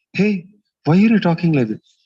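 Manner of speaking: a husband caught off guard, blurting it out; shocked husband. Retold or imitated. Husband reacting to his bored wife, startled and loud.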